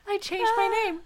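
giggling through the line; cracking up mid-sentence; laughing. Acted.

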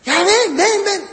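put-on mother calling a child mid-game, high and loud. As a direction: call the kid over, impatient. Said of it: "calling the child".

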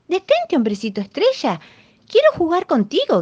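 high, loud call after the runaway. A narrator's reading. Old woman calls after the star man, high loud call. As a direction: shout to him to stop and play. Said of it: "gritaba la viejita".

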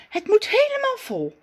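put-on high, chatty girlish voice, pleased with her plan; Zaza's voice. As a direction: play the vain little Zaza dreaming of beauty. Zaza's character voice. (hoge, tevreden stem van Zaza)

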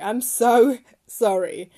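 giggling embarrassed sorry; laughing apology. Real, not acted. Laughing apology for butchering a name.

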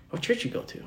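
hushed, breathy dad question; unvoiced mutter. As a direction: murmur it under the breath. Dad questioning son, quoted.

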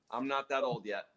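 ordinary delivery

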